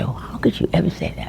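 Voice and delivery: quick whispered self-reproach. Real, not acted. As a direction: hush it to yourself, quickly.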